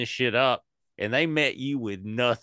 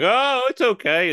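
a much higher, louder, airy mock reassurance; mock breezy dismissal; 14 semitones higher, 6 dB louder than usual. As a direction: squeak out a carefree it's okay. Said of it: "You shouldn't be like".

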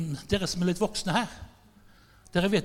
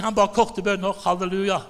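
ordinary delivery

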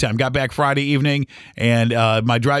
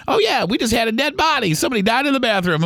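higher, breezy imitation of the staff; casual staff voice; 9 semitones higher than usual. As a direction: toss it off like old news, lightly voiced. Staff casually mentioning the dead body.

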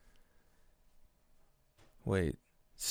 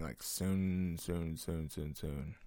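soon repeated, softer each time; fading repeat; 3 semitones lower, 5 dB quieter, slower than usual. Real, not acted. Host wishing to go camping, fading softer. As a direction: let the soons fade to nothing.

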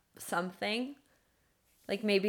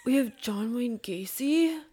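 higher, louder, slow announcer voice; ring announcer; 4 semitones higher, 4 dB louder, slower than usual. Pretend. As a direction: drag out the name like a fight intro. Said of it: "I can't do the ringer voice".

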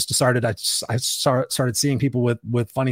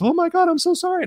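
much higher, put-on voice of an embarrassed woman; flustered apology; 3 dB louder than usual. Woman in line apologizes for her laugh, high and flustered. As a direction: imitate a stranger's high-pitched, mortified apology. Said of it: "She goes".

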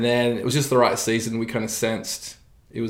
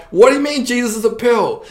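much higher, louder mock-puzzled listener; confused congregant; 12 semitones higher, 7 dB louder than usual. Pastor mimics a puzzled listener from his congregation, high and loud. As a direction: play the baffled churchgoer, pitched way up. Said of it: "So people think I just can't speak right".

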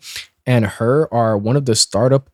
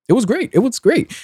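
high-pitched sarcastic praise; mock enthusiasm; 11 semitones higher than usual. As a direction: gush in a much higher voice, tongue in cheek. Ironic praise of getting viruses.

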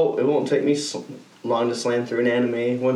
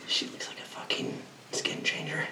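whispering; 15 dB quieter than usual. Acted. Conspiracy aside whispered.

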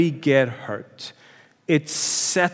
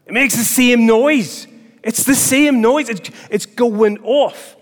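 higher and louder, slow and urgent; excited emphasis; 7 semitones higher, 4 dB louder, slower than usual. Real, not acted. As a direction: make them feel the alarm blaring. Imitating alarm, excited.

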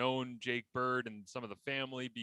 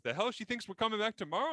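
mom's indignant quip; 10 semitones higher, 3 dB louder than usual. Retold or imitated. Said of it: "my mom would always go".

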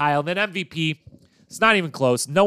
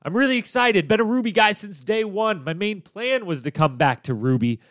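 higher, eager retelling of a contestant's words; excited quote; 8 semitones higher than usual. Retold or imitated. Host quoting a contestant glad to rejoin his team, excited and higher. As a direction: pitch it up like a thrilled team loyalist. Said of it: "says something to the effect of".

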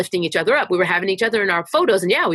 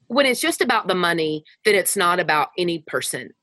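ordinary talk, nothing marked

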